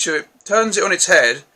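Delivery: normal talking voice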